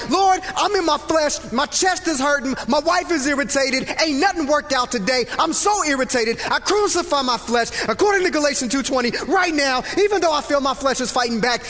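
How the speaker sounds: loud, high, driven prayer; fervent loud prayer. Retold or imitated. Husband praying aloud in a grocery store, loud and fervent. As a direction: pray it out hard in frustration. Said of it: "this is legit what I'm saying on the phone".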